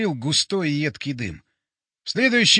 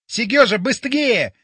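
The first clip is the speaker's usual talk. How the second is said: louder, higher urgent call; shout; 5 semitones higher, 4 dB louder, slower than usual. A narrator's reading. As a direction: call him to hurry, loudly. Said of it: "снова закричал Боря".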